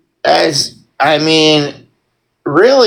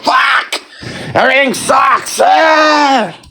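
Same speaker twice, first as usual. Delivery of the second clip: mock despair; 7 semitones higher, slower than usual. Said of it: "It's like".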